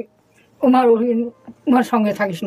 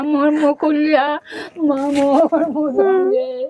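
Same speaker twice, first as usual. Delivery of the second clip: real, drawn-out crying lament; a mother keening at a reunion; tearful wailing; 2 semitones higher than usual. Real, not acted. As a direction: wail the words out slowly through tears. Mother wails on reunion with daughter.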